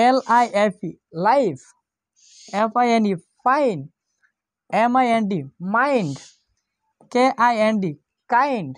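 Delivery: লাইফ, ফাইন, মাইন্ড, কাইন্ড each said with a long ai; spell then say long i. Shown as a demonstration. Teacher drilling life, fine, mind and kind for students. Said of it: "আয়ের শব্দটি কখন আয়ের মতো উচ্চারিত হয়".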